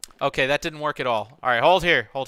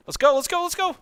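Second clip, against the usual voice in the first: urgent high shout; 4 dB louder than usual. Real, not acted. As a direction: yell to rally the squad. Rallying squad under fire.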